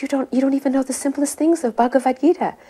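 high-pitched imitation of a guru's rebuke; retold heavy scolding. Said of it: "getting heavier and heavier with him".

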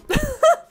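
short, loud high laugh; sudden high-pitched laugh; burst of laughter. Real, not acted. Player amused by the game's line, laughing. Reacts to the line just read.

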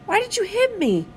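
high-pitched wounded question; hurt, near tears. A narrator's reading. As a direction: ask it high and hurt, without crying. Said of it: "I had tears in my eyes".

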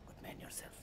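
whispered aside, no voice at all. Acted. Driver whispering a greeting to a boarding passenger. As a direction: breathe the greeting under your breath.

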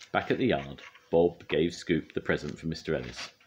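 usual speaking voice

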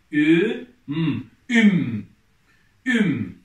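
loud, drawn-out syllable reading for the class; reading syllables aloud. Shown as a demonstration. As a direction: sound out each letter clearly, then blend them loudly. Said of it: "Okuyalım".

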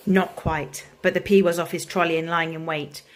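ordinary delivery, nothing marked

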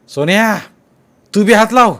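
put-on high, loud call to the boy; shouted order. A narrator's reading. Village headman yelling at Sonya to help, loud. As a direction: yell at him to lend a hand. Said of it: "police Patil ओरडला".

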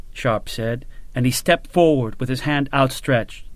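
plain reading voice

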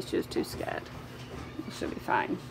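whispered aside so the dog isn't startled; hushed aside beside a nervous dog. Real, not acted. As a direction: breathe it out in a whisper. Quiet aside near scared dog.

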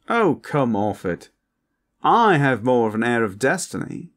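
posh vampire. Acted. Vampire rivaling the traveler's air of destiny, posh and indignant. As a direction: scoff like an upstaged vampire aristocrat.

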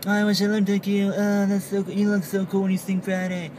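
high-pitched mock fan voice; imagined admirer. Onlookers admiring the singing driver, high mock fawning. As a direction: gush in a put-on high voice. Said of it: "look at me and be like".

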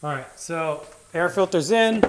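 normal talking voice

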